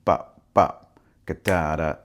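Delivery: Bop bop punched hard and loud; loud accented Bop bop; punchy hits. Shown as a demonstration. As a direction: hit each Bop like an accent. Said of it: "these hits on the upbeat".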